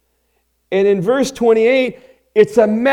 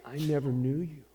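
quiet; 5 semitones lower, 14 dB quieter than usual. Retold or imitated. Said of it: "he's gonna say".

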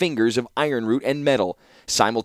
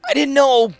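pleading; 6 dB louder than usual. A narrator's reading. Protesting innocence to brother.

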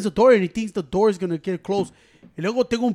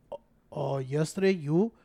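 meek Ciro voice; 7 semitones lower, 9 dB quieter than usual. Retold or imitated. Imitating Ciro's meek excuse.

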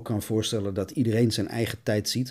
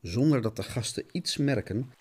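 dramatic storyteller voice, lightly heightened; lightly dramatised cartoon-serial narrator; radio play narration; 5 semitones higher than usual. Narrates radio play over music.

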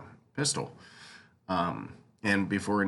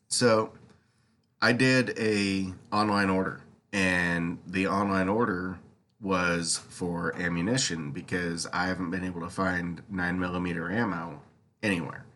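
ordinary talk, nothing marked

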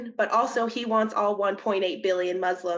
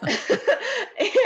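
laughing; 14 semitones higher, 5 dB louder than usual. Real, not acted. Laughing on after her own remark.

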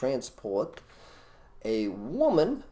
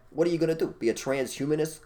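usual speaking voice

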